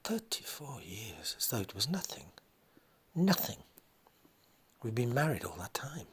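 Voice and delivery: mother mourns thirty-four years of marriage; broken whisper. Acted. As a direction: whisper it as grief overwhelms her. Wife grieving to her son.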